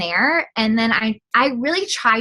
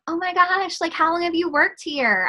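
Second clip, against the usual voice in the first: higher, eager and friendly; chatty customer demo; 8 semitones higher than usual. Shown as a demonstration. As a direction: play the chatty donut shop customer opening a talk. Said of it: "say I'm the lady at the donut shop".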